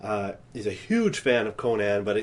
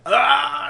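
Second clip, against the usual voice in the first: much higher anguished cry; mock yell; 13 semitones higher, 4 dB louder than usual. Acting out Vader's scream, mock anguished cry. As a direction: let out a hammed-up wail. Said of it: "He yells".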